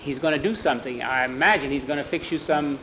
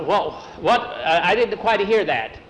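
usual speaking voice

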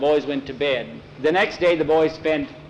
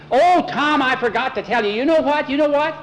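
put-on high, eager boy bursting with news; excited outburst; 11 semitones higher, 4 dB louder than usual. A narrator's reading. Boy remembers news to tell Tom, excited. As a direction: burst out, climbing higher to the end.

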